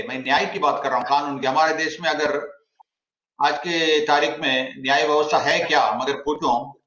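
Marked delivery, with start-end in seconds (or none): none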